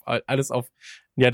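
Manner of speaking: ordinary delivery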